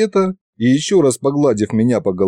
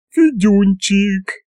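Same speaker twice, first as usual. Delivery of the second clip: higher, slow, fond call of a village woman; tender aunt Yaguta; 9 semitones higher, much slower than usual. Acted. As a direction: coo the boy's name like a doting aunt. Yaguta fondly greets Fedya. (ласково, высоким голосом)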